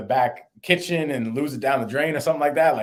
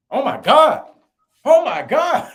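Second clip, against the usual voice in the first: high, loud mock shriek of disbelief; shocked; 11 dB louder, slower than usual. Girlfriend sees the ring message, high loud shocked shriek. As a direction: shriek it like she just saw the proposal. Girlfriend realizes it's a proposal.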